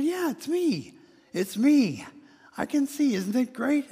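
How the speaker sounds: high, bright-eyed and loud with joy; excited joy. Acted. Healed man telling neighbors, gleefully excited. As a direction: burst out gleefully, pitch way up.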